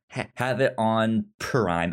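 ordinary delivery

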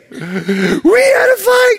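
hammed-up tearful wail; mock weeping. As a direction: wail it out heartbroken. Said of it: "She was weeping".

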